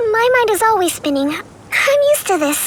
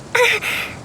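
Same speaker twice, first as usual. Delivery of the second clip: embarrassed little laugh; 3 semitones higher than usual. Acted. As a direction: let a bashful laugh slip. Caught smiling, embarrassed.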